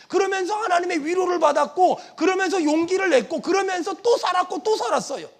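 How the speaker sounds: loud, driving sermon cadence; rousing pulpit proclamation; full-force preaching. Real, not acted. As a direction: build clause on clause with forceful conviction.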